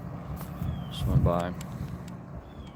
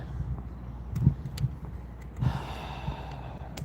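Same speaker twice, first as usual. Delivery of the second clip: heavy sigh; 8 dB quieter than usual. Real, not acted. Sad scene, coroner present.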